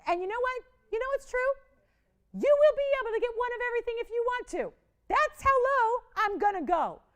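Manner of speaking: loud, high-pitched excited sales pitch; thrilled. Real, not acted. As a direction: get carried away with delight, high and loud. Host thrilled at a customer's choice.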